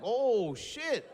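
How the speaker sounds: high, cracking surprised exclaim; shocked realisation. Retold or imitated. Fighter realising he punched his own car, high shocked cry. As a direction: catch the mistake mid-laugh.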